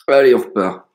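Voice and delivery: loud, pumped-up call; rally yourself loudly; hyped shout. Real, not acted. Restarting the game with energy.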